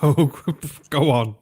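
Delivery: ordinary delivery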